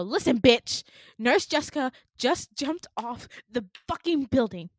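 loud, emphatic retold outburst; angry confrontation. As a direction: hit each word hard, acting out fury.

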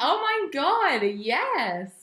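high, loud, drawn-out delight; thrilled. Real, not acted. Friend hearing the vision board read out, thrilled. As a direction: cheer her on, elated.

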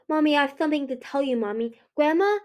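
put-on young son's lifted, eager voice; calling child. A narrator's reading. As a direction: tattle to mother in a child's voice. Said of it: "Cliff called".

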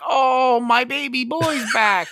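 high, slow gushing mother voice; mock mom. Imitating the mom greeting her returning son. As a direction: gush like a doting mom. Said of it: "she's like".

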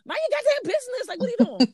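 very high, quick comic mom scolding; mock scolding. Mom telling daughter to mind her business, high mock scolding. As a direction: shoo the nosy kid off in a squeal, laughter creeping in.

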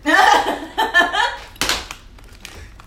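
high, loud cackle that tapers off; real cackle. Real, not acted. Cracking up with a friend, high loud laugh. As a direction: crack up loudly, then let it fade.

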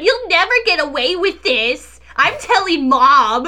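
high, whiny tattletale threat; a petulant child yelling a threat; bratty kid voice. Pretend. Mocking a bratty kid fairy.

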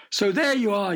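high, loud kingly yell. Acted. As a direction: yell it at the lost knight. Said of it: "yelled the king".

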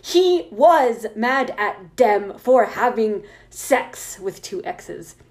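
emphatic, drawn-out indignant rant; character voice. Acted. Author refusing to update without reviews, indignant character voice. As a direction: punch out each misspelled word slowly. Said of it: "read it in my character voice".

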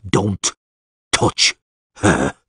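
word by word, with pauses; menacing warning. A narrator's reading. Geralt cuts wizard off, threatening.

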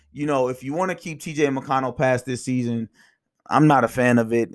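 ordinary delivery

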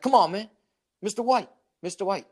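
mock insistent begging; pestering beggar. Imitates panhandler pestering them.

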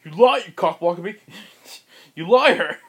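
high, loud accusing shout; furious yell. Retold or imitated. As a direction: scream the accusation in his face. Bull yelling at intruder.

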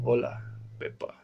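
soft, sheepish hello; quiet greeting. Real, not acted. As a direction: say hi under your breath. Greeting Peppa Pig quietly.

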